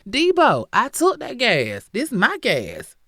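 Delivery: loud, high taunting yell at the owner; taunting shout. Retold or imitated. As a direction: crow it in his face, loud and high. Robber taunting the station owner.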